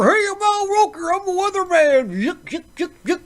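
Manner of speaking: high, hammed-up goofy weatherman; mock the jolly TV weather guy, yucking it up; silly, high chuckling weatherman caricature; Al Roker impression. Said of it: "And you got Al Roker".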